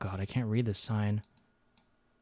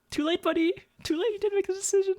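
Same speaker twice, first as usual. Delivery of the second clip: high squeaky taunting voice; mock taunt. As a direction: tease like a cartoon villain.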